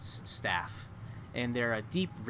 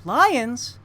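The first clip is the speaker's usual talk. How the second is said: high, loud startled gasp of a word; a frightened girl echoing; the girl reacts in alarm, high and loud; alarmed woman; 10 semitones higher, 10 dB louder than usual. Acted. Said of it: "the woman says".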